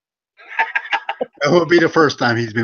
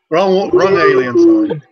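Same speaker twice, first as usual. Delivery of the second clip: laughing; 7 semitones higher, 5 dB louder than usual. Real, not acted. Laughing hard at own joke.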